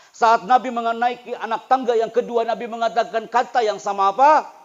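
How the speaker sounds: loud, high, impassioned sermon; loud, high storytelling sermon about the weeping palm; full-force preaching. Acted. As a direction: preach it with fire to the students. Preaching continues.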